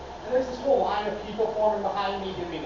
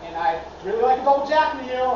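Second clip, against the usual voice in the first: usual speaking voice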